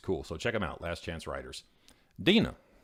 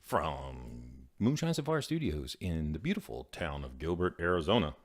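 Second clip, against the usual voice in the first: announcer voice; 4 semitones lower than usual. Shown as a demonstration. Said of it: "I should be an announcer".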